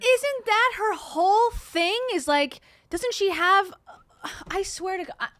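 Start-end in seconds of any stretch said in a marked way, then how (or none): 0.0-3.7 high, slow disbelief; can you believe this, rising; incredulous. Real, not acted. Incredulous about the influencer.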